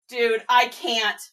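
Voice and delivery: normal talking voice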